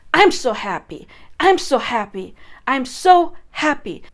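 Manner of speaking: loud, forceful declarations; powerful incantation. Shown as a demonstration. Coach showing a powered-up incantation out loud. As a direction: put your power into every word. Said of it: "you put your power into it".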